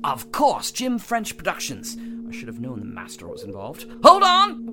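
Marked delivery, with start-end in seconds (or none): 4.0-4.7 high loud warning shout. Acted. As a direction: brace them loudly. Warning before travel.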